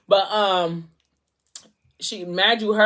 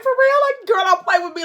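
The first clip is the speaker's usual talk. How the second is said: much higher and louder, stunned "for real?"; shocked disbelief; 8 dB louder than usual. Retold or imitated. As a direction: squeal it like you can't believe she said yes. Her own reaction to the yes.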